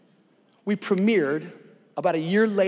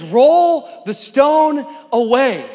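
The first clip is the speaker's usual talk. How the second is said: slow, raised, proclaiming order; commanding; 7 semitones higher, 10 dB louder, much slower than usual. Acted. Jesus at the tomb giving the order, commanding. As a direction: stretch each key word with authority.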